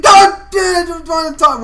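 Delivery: high, loud petulant whine; a kid's high, loud whine; whiny yell. Retold or imitated. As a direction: complain loudly like a bratty kid. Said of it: "And he's like".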